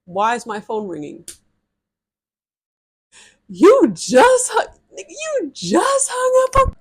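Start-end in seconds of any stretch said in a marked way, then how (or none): 3.5-6.8 high, slow, stretched squeal of disbelief; shocked disbelief. Retold or imitated. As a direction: drag it out in outraged shock, way up high. Outraged at being hung up on.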